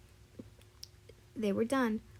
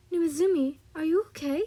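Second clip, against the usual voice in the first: put-on higher, louder urgent check on a child; raised, concerned question; worried calling out; 7 semitones higher, 7 dB louder than usual. A narrator's reading. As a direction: call out with concern as you rush over. Worried mother rushing to crying child.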